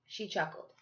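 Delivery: usual speaking voice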